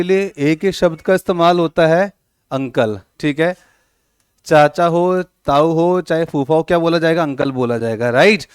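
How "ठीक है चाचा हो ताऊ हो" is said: normal talking voice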